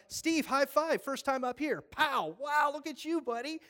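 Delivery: high, loud, hyped-up greeting; excited cheer. Retold or imitated. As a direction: cheer your healed friend on like a fan. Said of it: "I'm like".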